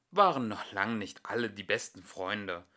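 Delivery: ordinary delivery, nothing marked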